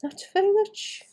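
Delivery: showing off a slight Welsh accent; put-on Welsh. Shown as a demonstration. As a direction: lilt it a bit Welsh, playfully. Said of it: "I can make myself sound like I'm a little bit Welsh".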